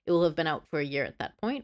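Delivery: usual speaking voice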